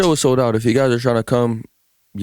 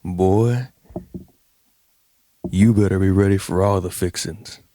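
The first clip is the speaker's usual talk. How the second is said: low-pitched Southern twang; Southern drawl impression; 4 semitones lower than usual. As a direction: put on a folksy Southern drawl with pauses. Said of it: "I just wanna hear that Southern drawl".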